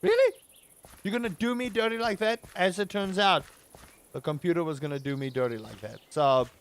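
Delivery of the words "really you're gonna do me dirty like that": high, loud, incredulous; outraged disbelief. Retold or imitated. Retelling how the computer betrayed him, high outraged disbelief. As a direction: squeak out a stunned are you kidding me. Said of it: "I'm just like".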